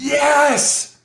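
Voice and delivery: high, drawn-out heated yes; emphatic outburst. Acted. Angry subject answering a negotiator who gets him, emphatic. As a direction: cry it out as if finally understood. Acting the raging person's relieved agreement.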